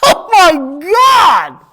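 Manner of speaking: loud, high, drawn-out outcry; mock shock. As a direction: stretch out a scandalized yell. Mock shock at offensive costume caption.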